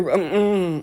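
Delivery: ordinary talk, nothing marked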